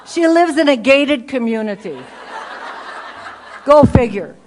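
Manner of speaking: loud, laughing through the words; laughing punchline. Real, not acted. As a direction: deliver it amused and incredulous.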